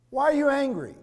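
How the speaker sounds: high, loud demand of the question; God's challenge rung out; pointed question. Acted. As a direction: press the question hard. Preacher voicing God's question.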